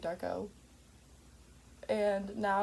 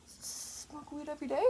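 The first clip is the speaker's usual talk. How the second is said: hushed, higher-pitched questioning; furtive; 6 semitones higher, 11 dB quieter than usual. Pretend. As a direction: sneak it out very softly, pitched up. Furtive repeat, "they didn't see.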